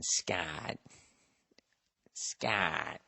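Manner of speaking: low, quiet gravelly-free mock Douglas growl; a lightly done Michael Douglas, low and soft; low murmured imitation of Michael Douglas; Michael Douglas impression. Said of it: "do her Michael Douglas impression".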